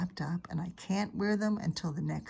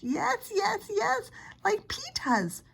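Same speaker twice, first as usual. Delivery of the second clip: shrill, much higher insistent yes; hollering toddler; much slower than usual. Acted. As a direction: yell it like a stubborn small boy. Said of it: "Fudge hollered".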